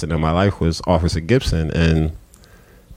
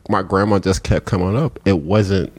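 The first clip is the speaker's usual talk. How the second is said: ordinary delivery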